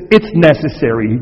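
It's necessary said low and deliberate; stressing necessary. Shown as a demonstration. Pastor correcting a popular quote, stressing necessary. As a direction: lean hard on necessary. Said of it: "Can I just say this".

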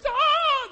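loud, high scream of fright; mock terrified scream. Fishermen falling over in fear, mock terrified scream. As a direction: scream it suddenly, hammed-up terror.

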